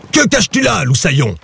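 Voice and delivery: high-pitched growling pirate demand. A narrator's reading. Pirate snatches Loup's map, growling. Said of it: "grogna le pirate".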